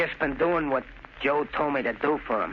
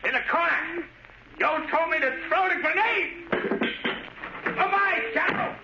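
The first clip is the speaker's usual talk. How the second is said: high, wild, unhinged threats; frantic and crazed; 10 semitones higher than usual. Acted. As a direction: lose control, voice cracking up high. Crazed son threatens with grenade.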